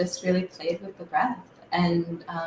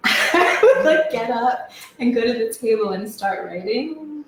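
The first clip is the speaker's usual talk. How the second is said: laughing; 5 semitones higher, 4 dB louder than usual. Real, not acted. Laughing while telling.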